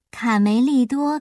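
usual speaking voice

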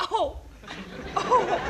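a high falsetto 哦 sob, lightly done; mock sobbing whimper. Joking about an actor rubbing off his eye makeup while sobbing. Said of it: "你要真这么擦".

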